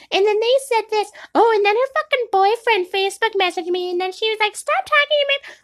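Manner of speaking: mock a gossip spilling drama; airheaded gossiping friend; gossiping girl. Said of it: "all of them are going back and forth being like".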